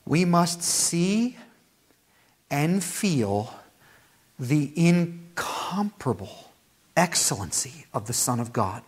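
savoring each word slowly; slow, deliberate scripture reading. Shown as a demonstration. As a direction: read it slow so they taste it. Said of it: "I'm gonna read it slowly so you can savor this".

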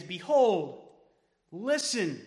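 Behold punched loud, then listen; emphatic. Pretend. Pastor voicing Jesus to disciples, emphatic. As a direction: call out Behold firmly. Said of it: "Jesus is being emphatic".

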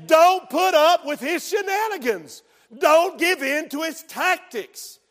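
high, loud, emphatic sermon shout; fiery pulpit exhortation; full-force preaching. Real, not acted. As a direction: thunder a warning to the congregation.

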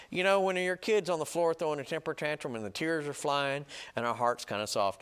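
usual speaking voice

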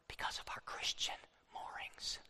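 hushed breathy whisper to the crowd. Real, not acted. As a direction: lean in and whisper it like a secret. Preacher drops to a hushed aside.

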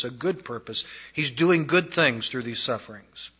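usual speaking voice

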